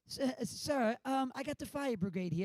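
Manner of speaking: high-pitched British front desk man; hesitant, put-on British accent on the phone. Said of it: "I do a great British accent".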